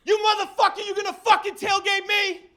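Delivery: high screaming road rage; high shrieking angry rant; furious yell. Retold or imitated. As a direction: scream at the driver who cut you off. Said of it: "he goes".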